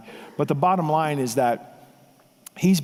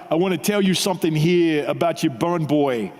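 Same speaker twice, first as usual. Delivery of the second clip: mild mock New York mafia accent. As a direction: lightly voice a knuckle-dragging New York tough guy. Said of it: "in this big-time New York accent".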